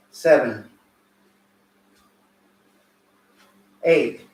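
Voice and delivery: loud count, short punchy calls; boxing coach calling combos. Acted. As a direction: call each combo like a coach.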